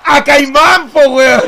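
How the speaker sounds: loud, high burst of delight; excited shout. Real, not acted. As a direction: yell it out in glee. Joke lands, both laughing.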